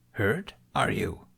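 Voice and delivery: put-on slow, softly probing old creature voice; lightly voiced ancient master asking gently; Yoda. A narrator's reading. Yoda asks Ben about his hurt, slow and soft.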